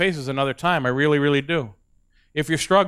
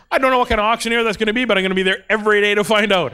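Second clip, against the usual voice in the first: fast; 4 semitones higher, 3 dB louder, faster than usual. Real, not acted.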